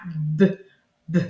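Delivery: short, separate bursts of the B sound; bouncing B sound. Shown as a demonstration. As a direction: bounce the sound of B, with a pause between. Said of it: "on va faire sauter".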